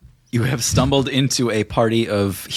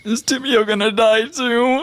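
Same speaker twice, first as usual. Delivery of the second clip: much higher, small tearful question; teary Dave. Acted. Dave asking Uli if Tibio will die, high and teary. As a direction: ask through tears. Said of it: "teary eyes, and he says".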